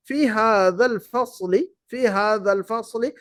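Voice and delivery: فی ھذا الفصل read slowly and clearly; slow model reading. Shown as a demonstration. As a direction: say it slowly for the class. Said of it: "آخری جملہ دیکھتے ہیں". (آہستہ آہستہ پڑھنا)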